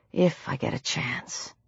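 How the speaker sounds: low, very soft private thought; resigned murmur. Acted. Heroine to herself as she sheathes her knife, resigned murmur. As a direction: let it drop away under the breath.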